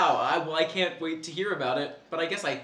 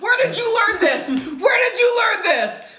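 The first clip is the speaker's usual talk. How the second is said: shocked shout; 9 dB louder than usual. Acted. Shocked parent yelling at child.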